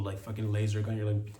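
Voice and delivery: normal talking voice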